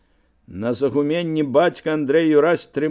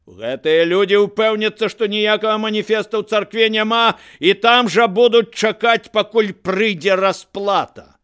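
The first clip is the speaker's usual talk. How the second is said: high, loud public proclamation; raised orator's voice; addressing the crowd; 7 semitones higher, 5 dB louder than usual. A narrator's reading. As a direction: project to a whole square.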